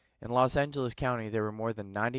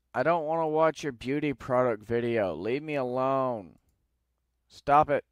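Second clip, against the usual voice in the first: annoyed at pop-ups; 4 semitones higher, 6 dB louder than usual. Real, not acted. Angry at pop-up video ads.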